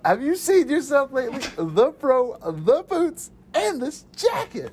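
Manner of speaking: high, teasing mock teacher, slowing on the jacket. Podcaster quoting the teacher teasing a student's outfit, high mocking. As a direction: poke fun at a student's seventies outfit. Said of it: "He's like".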